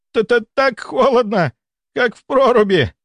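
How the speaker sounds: slow, high-pitched shivering stutter; teeth chattering. A narrator's reading. Patient freezing on the couch, teeth chattering. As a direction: shake with cold on every word. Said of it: "стучал он зубами".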